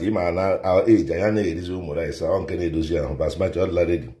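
ordinary delivery

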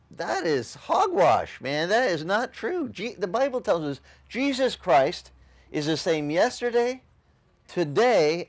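usual speaking voice

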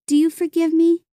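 put-on high, hopeful girl's question; pleading older sister. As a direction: ask forgiveness with the pitch lifted.

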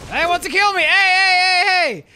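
loud, high hey hey; shouting. Real, not acted. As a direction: yell at a betrayer. Teammate attacking him.

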